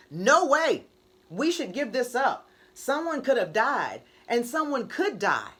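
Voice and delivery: loud, urgent plea to quit; alarmed protest. Acted. Marcher begging the others to give up, loud and urgent.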